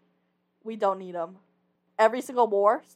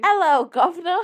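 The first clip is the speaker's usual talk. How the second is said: high, loud hammed-up British greeting; mock British accent; 9 semitones higher, 8 dB louder than usual. Shown as a demonstration. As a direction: put on a cheesy British accent. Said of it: "different accent, ac-, accent for you".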